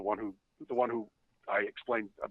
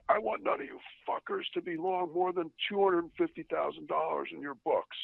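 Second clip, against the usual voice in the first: higher-pitched mock boss laying down an order; imitated boss issuing a decree; boss's edict; 8 semitones higher than usual. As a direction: lay down the law as an irate boss, pitched up.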